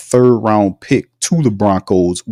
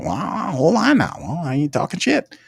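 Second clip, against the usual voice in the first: higher, softer put-on voice of a sly executive; Slick Mickey; 8 semitones higher, 4 dB quieter than usual. Streamer voicing the GM answering chat, higher sly put-on voice. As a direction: play the slippery boss brushing off a jab. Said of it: "we got Slick Mickey in the room".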